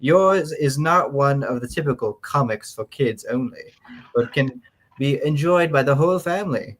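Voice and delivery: mannered fifties reader with a put-on British lilt; nineteen-fifties voice. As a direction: play an earnest fifties magazine reader. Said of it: "in your best nineteen fifties voice".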